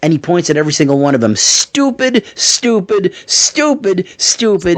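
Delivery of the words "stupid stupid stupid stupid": high, jeering taunt at each fan; mock taunt. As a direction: point and jeer, one by one. Wrestler pointing out each fan.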